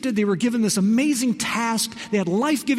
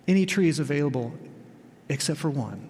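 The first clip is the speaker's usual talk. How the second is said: ordinary talk, nothing marked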